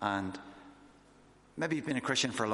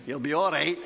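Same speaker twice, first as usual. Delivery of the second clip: louder, put-on Scottish voice; Scottish accent; 5 semitones higher, 7 dB louder than usual. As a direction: imitate a reassuring Scottish pastor, loudly. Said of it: "in a Scottish voice".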